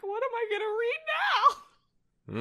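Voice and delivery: put-on a kid protesting the new limit; dismayed kid. Kid protesting mom's one-book rule, in a high mock whine. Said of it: "And I was like".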